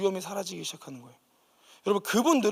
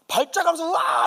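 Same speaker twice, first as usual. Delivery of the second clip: high loud mock yell of a seized woman; retold fit scream; 9 semitones higher, 5 dB louder than usual. Possessed woman shrieking in a fit, loud mock yell. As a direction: let out a sudden imitated shriek. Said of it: "무슨 소리를 지르는데".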